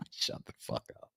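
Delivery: a breathy unvoiced whisper, half joking. Real, not acted. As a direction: whisper it like a mock scolding. Mock rebuke, whispered.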